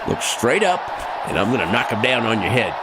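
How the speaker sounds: calling out. Acted. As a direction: call it out like a ringmaster. Staging a stunt, calling out.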